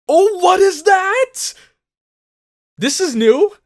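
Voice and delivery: loud, high-pitched astonishment; startled amazement. Real, not acted. Viewer sees the pendant do something new, startled amazement. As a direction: exclaim in shock, voice jumping up and rising at the end.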